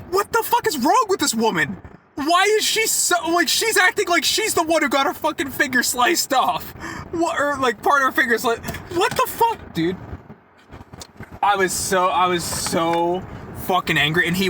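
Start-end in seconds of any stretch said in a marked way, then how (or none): 0.1-10.0 high-pitched, exasperated retold rant; outraged disbelief. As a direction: rant in baffled outrage at the customer. Retelling his outrage at customer.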